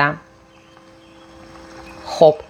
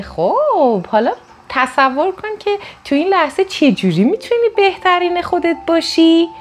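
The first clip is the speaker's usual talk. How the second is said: much higher, put-on preachy voice; mock the calm advice-giver, pitched way up; imitating an advice-giver; 11 semitones higher than usual. Someone preaches calm during an argument, mock high voice. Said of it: "someone gives advice mid-fight".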